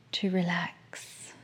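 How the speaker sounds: soft whisper, slow and close; right in your ear. Acted. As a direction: breathe the words right in the ear. Whispered relaxation cue.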